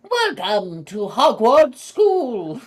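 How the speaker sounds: high, loud and very slow Scottish attempt; Scottish accent. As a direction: stretch it out in a hammy Scottish accent. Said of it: "the Scottish accent".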